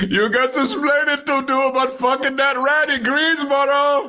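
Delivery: high, wailing comic shout; hammed-up high-pitched telling-off; mock scolding. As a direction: holler it like an outraged sitcom spouse. Sitcom parody of an angry spouse.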